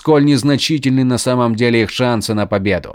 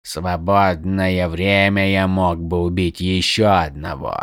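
growling; 4 semitones lower than usual. A narrator's reading. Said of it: "прорычал Шинец".

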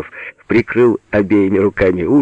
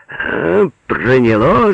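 raised, gleeful taunt; gloating; 6 semitones higher, much slower than usual. A narrator's reading. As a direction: crow over your victim. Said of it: "злорадствовал Алёша". (злорадно)

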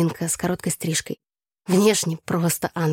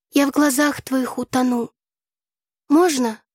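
higher, declaimed verse; solemn recitation; 7 semitones higher, slower than usual. A narrator's reading. Tasya starts her poem over for her friend, solemn declaiming. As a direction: open the poem with ceremony. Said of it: "начала торжественно Тася".

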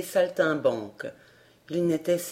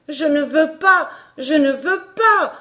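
put-on higher, louder struggling child; boy crying out; 7 semitones higher, 5 dB louder than usual. A narrator's reading. Emmy fighting the catiche's grip, higher and louder. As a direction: cry out while struggling. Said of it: "cria l'enfant".

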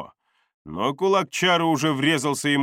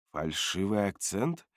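soft, rising query; questioning echo; 3 semitones lower, 7 dB quieter than usual. A narrator's reading. Чара checks what Бардак said, soft questioning echo. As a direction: repeat it softly as a question. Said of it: "переспросила Чара".

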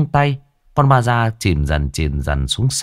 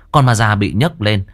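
plain reading voice

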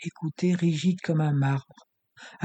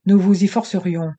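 louder, curt and final; villain refusing to let her leave; brutal, categorical; 4 semitones higher, 9 dB louder than usual. Acted. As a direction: shut her down flatly and hard. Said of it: "brutal et catégorique".

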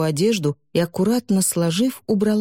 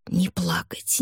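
urgent whisper; 5 dB quieter, slower than usual. A narrator's reading. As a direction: breathe it out, steeling yourself. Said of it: "твердил он себе".

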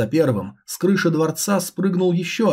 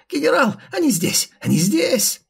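much higher shrill alarm cry; screeching ghoul; 9 semitones higher than usual. Acted. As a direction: shriek to summon the general. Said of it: "лицо в земле снова завопило".

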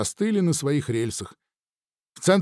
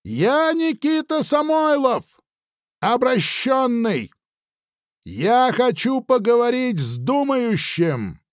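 calling out; 9 semitones higher, slower than usual. Acted. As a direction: announce yourself slowly to the unseen enemy. Calling out to hidden shooters.

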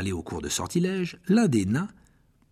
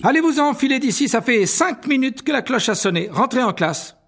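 high, loud prefect order; scolding; 13 semitones higher, 6 dB louder than usual. Acted. Percy dispersing the crowd of students, high loud scolding. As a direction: shoo them to class, loudly.

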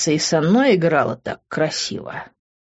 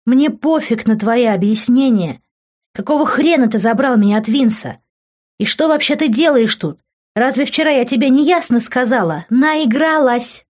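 higher voice of an outraged woman; indignant tirade; 6 semitones higher, 4 dB louder than usual. A narrator's reading. Furious girl rejects his explanations, indignant. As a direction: rail at him, pitched up.

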